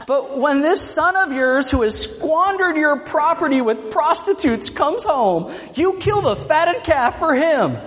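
a whiny middle schooler griping to his parent. Acted. Older brother whining to father like a middle schooler. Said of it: "the little whiner middle schooler in me".

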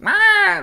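sudden high loud "ah"; shrill cry of pain, shrugged off; mock pained yelp. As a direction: yelp like a friend who just ate it. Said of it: "And they were like".

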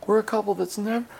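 high-pitched mock old couple boasting; bragging grandparents. Grandparents bragging about their marriage, mocking high-pitched boast. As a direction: sing out the boast in a put-on high voice. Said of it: "they would always brag".

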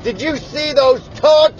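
put-on high-pitched Boston vendor calling to the boys; Boston accent. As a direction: imitate an excited Boston guy. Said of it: "the guy had the best accent ever".

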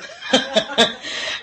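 breathy laugh rising high, then falling away; a quick amused laugh at herself; short high laugh. Real, not acted. Laughing at her own joke.

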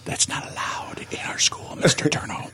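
whispered scolding; hushed principal voice; mock principal whisper. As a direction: hush them like a stern principal. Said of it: "the principal just kinda looked at me".

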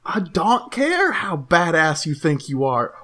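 normal talking voice

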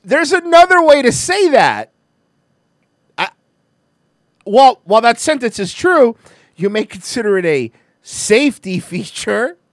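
sarcastic. Real, not acted. Mocking the news line.

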